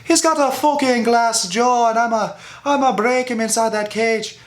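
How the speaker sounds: mock Conor trash talk. Ranting vlogger mocks Conor's trash talk, high mocking voice. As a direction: sneer his brag back at him, voice pushed way up. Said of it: "shit talking me like".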